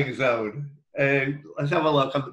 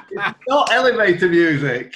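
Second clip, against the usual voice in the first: higher and louder, laughing through the words; laughing correction; 4 semitones higher, 6 dB louder than usual. Real, not acted. Quiz host laughing at a player's wrong answer. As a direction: correct him while cracking up.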